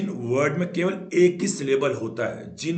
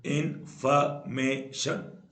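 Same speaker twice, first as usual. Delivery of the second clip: Information slowly split into four beats; syllable by syllable; much slower than usual. Shown as a demonstration. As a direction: pause between each syllable of information. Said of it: "जिसमें चार syllable होते हैं".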